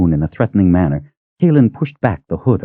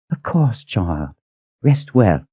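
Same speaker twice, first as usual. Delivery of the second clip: put-on tender old cook; 2 semitones higher, 4 dB quieter, slower than usual. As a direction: soothe her like a grandmother.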